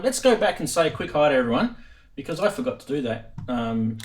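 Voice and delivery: ordinary talk, nothing marked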